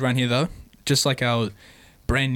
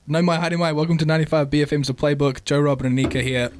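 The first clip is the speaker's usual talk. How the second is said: a louder, upbeat radio host opening; radio host intro; 4 semitones higher, 4 dB louder than usual. Acted. Opening the BFM podcast for listeners, bright radio host voice.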